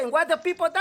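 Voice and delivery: ordinary talk, nothing marked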